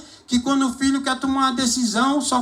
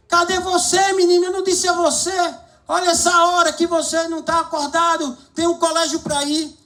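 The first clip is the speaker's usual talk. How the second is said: irritated father; 8 semitones higher, faster than usual. Retold or imitated. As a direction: hurry the boy out of bed, annoyed. Father scolding late son. (pai irritado, alto, agudo e rápido)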